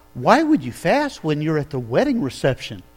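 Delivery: ordinary talk, nothing marked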